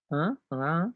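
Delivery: normal talking voice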